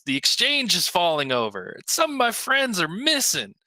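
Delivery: miner's cry, pitch leaping up at the end; mock shout. A narrator's reading. Miner protesting to the town hall, a contained shout. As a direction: call out in alarm without much volume. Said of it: "a human miner shouts".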